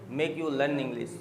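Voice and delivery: slow, corrective model phrase; slow correction; slow dictation. Shown as a demonstration. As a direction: lay out each word slowly. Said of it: "teacher corrects student".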